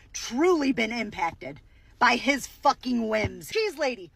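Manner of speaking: ordinary talk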